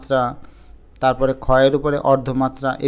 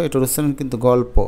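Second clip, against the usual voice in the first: ordinary talk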